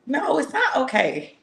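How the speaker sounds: high-pitched comic protest; mock indignant refusal. Refusing a friend's quick okay, mock indignant refusal.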